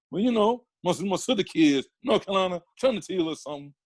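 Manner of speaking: Bernie Mac. Acted. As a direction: act out a comedian telling a family story. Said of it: "But Bernie was Bernie".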